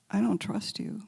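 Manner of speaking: hushed, voiceless plea; a confession said barely aloud; whispered prayer. Retold or imitated. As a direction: breathe it to God in a whisper.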